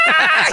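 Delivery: high, loud terrified scream; frightened scream. Retold or imitated. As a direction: shriek as he runs off. Fleeing man screams in fright.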